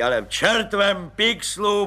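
high, slow blissful bellow; blissful roar. Acted. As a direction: boom it out in bliss. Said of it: "burácel blaženě plukovník".

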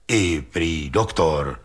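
low, slow, lightly voiced bystander; a hint of a scornful onlooker; dismissive bystander. A narrator's reading. Bystander's scornful answer in story.

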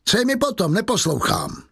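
high-pitched scornful dismissal; angry refusal. A narrator's reading. Inspector refusing the prisoner's private plea, angry refusal.

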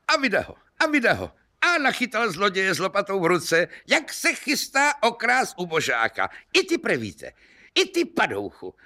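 high-pitched, gleeful outrage at a caught thief; worked-up, high-pitched accusation; excited pouncing cry. Acted. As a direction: pounce on the culprit in a high, indignant voice.

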